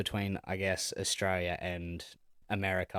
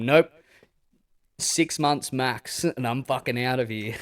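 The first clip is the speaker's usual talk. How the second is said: defiant; 4 semitones higher, 7 dB louder, slower than usual. Retold or imitated. Retold defiant inner vow.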